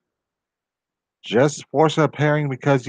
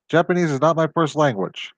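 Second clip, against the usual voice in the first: ordinary delivery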